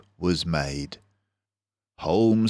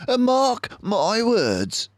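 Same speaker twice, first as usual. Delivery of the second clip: high, slow knowing voice; a sharp little sleuth pronouncing a warning; Basil the mouse detective; slower than usual. Acted. Basil warning his friend, high and deliberate. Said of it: "he told me".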